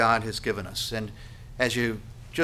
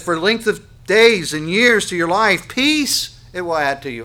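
loud, raised preaching voice; forceful scripture reading; 7 semitones higher, 10 dB louder than usual. Acted. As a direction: proclaim the verse to the whole congregation.

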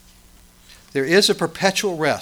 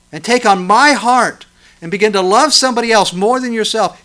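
loud, high impassioned pleading; full-force preaching; 8 semitones higher, 11 dB louder than usual. Acted.

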